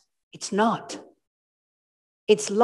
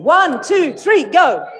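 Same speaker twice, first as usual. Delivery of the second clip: loud count-off; 6 semitones higher, 4 dB louder, slower than usual. Real, not acted. Counting off audience exercise.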